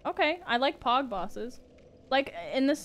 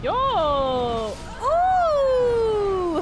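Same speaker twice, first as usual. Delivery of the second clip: loud, high yelps of hype; excited exclaim; 11 semitones higher, 9 dB louder than usual. Real, not acted. Streamer to chat at a big game moment, loud excited yelps. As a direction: shout out in real surprise. Reacting to boss fight moment.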